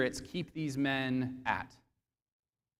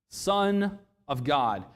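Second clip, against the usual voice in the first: usual speaking voice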